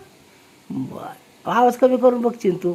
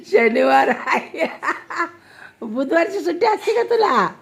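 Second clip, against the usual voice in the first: laughing while teasing, voice pitched up; laughing tease; 5 semitones higher than usual. Real, not acted. Grandmother teasing the child about Wednesday, laughing. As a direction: chuckle through the question.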